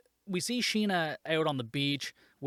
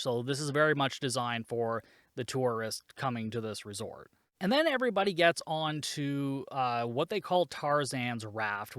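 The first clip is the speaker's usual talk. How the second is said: ordinary talk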